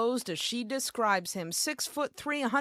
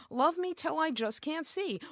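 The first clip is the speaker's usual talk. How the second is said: bemused, higher-pitched deadpan lyric quote; puzzled quote; 7 semitones higher than usual. Retold or imitated. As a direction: quote the lyric back with a raised eyebrow. Questions the song's lyric.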